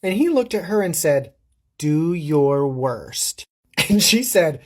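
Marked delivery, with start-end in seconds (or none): 1.8-3.5 very slow, drawn-out dare; imitated taunting dare. Boy daring her to tell the adults, slow taunt. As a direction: spell out the challenge word by word, very slowly.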